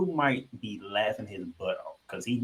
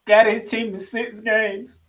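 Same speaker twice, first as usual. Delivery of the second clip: excited; 7 semitones higher, 9 dB louder than usual. Real, not acted. Hyped rant about Embiid.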